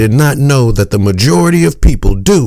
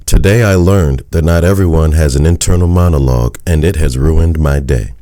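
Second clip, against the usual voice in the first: much lower-pitched, level title reading; 10 semitones lower than usual. A narrator's reading. Narrator citing the article's title to listeners, low-pitched. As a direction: drop the voice right down to cite the title. Reads out the title after attribution.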